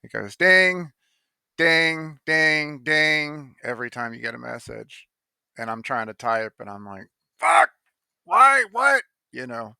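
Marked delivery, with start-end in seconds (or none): 0.4-3.6 high, loud ringing "ding" repeated; mimicked messenger alert dings, loud and high; annoying message ding. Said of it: "how an-, annoying it is". 7.4-9.0 very high, loud mock outburst; squawked, over-the-top irritation; exasperated yell. Retold snapping at message alerts.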